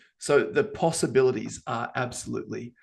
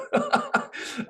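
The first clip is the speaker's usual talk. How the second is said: a sudden squeaky laugh; high burst of laughter. Real, not acted. Presenter cracking up at her own voice, high laugh. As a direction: break into a high giggle.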